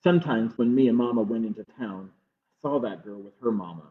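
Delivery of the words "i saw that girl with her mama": ordinary delivery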